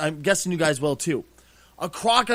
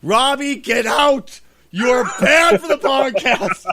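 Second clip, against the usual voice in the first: higher and louder, hammed-up outraged shout; mock banishment; 7 semitones higher, 8 dB louder, slower than usual. Banning his friend from the podcast for a bad movie pick, mock shouting. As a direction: throw him out in jest.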